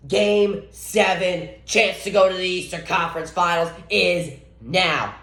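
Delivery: slow, drawn-out announcer intro; hype intro. Acted. Vlogger opening a game seven preview, slow hype announcer voice. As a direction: stretch each phrase like a big-game announcer.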